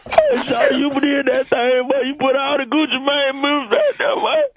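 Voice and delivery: hammed-up tearful gushing, voice leaping high; inconsolable. Radio caller thanking [name], inconsolable. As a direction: wail your love through mock sobs. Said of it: "You inconsolable right now".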